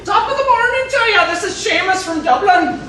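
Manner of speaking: a hammed-up Irish brogue with a Texas twang; mock Irish caller, lightly done; Texan Irish accent. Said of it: "my Texan Irish accent is not good at all".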